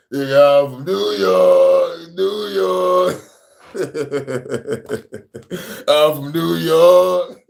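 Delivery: a hint of [name], stretched rap chant. Putting on a [name] voice for the co-host, rap chant. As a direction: rap it like [name] doing a hook. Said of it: "Let me put on my [name] voice".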